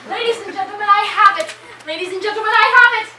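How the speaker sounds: loud, high showman's call; a loud, grand reveal to the troupe; triumphant announcement. Acted. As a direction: announce it like a ringmaster.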